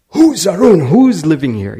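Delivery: loud, high midnight shout; yelling at the door. Retold or imitated. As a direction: bang on the door and yell his name. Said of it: "Someone's yelling my name".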